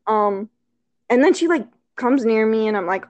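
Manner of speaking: ordinary talk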